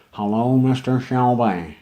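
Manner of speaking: put-on slow, overdone movie Irish accent; mock a Hollywood actor's clumsy Irish accent; bad fake Irish accent. Poet mocks an actor's Irish accent, slow and hammed-up. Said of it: "an accent which non-Irish professional actors can't".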